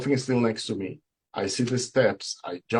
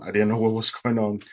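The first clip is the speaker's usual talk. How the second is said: ordinary delivery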